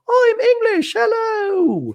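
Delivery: shrieking high, loud fake English accent; hammed-up English hello, drawn out at the top of the voice; bad English accent. Host mocks second housewife in London, squealed bad English accent. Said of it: "a lot of bad English accents".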